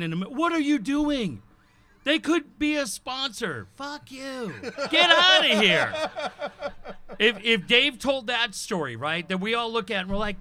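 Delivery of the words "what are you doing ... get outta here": slow, higher manager scolding, ending in a loud yell; mock angry sales manager. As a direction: play the furious boss, mock style. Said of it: "I would have the sales manager in".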